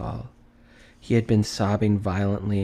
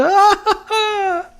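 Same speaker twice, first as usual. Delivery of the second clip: high, loud joyous burst of laughter; splendid laugh; 8 dB louder than usual. A narrator's reading. Scrooge out of practice, laughing a splendid laugh. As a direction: let out a huge delighted laugh. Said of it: "it was a splendid laugh".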